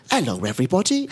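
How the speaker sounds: a light South London English greeting; London-accented hello, lightly put on. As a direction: play it as a kid with a London accent. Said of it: "With a very English accent".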